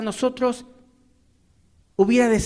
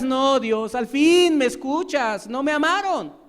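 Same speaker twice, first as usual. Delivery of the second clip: slow, higher self-pitying plea to God; pleading complainer; 5 semitones higher, 4 dB louder, slower than usual. Pretend. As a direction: beg God with the hurt laid on thick. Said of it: "y tú dirás".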